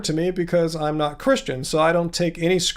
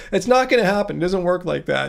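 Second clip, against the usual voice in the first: ordinary talk, nothing marked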